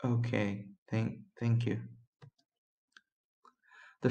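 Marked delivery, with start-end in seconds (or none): 0.0-1.8 soft, passive reply. A narrator's reading. Replying to the police boss, passive. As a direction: give in meekly, voice dropped. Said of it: "I replied passively".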